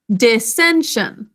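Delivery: dissension said slowly, syllable by syllable; slow syllable demo. Shown as a demonstration. Teacher modeling a spelling word slowly for students. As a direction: draw out Dissension for the class to repeat. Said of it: "Would you say dissension with me?".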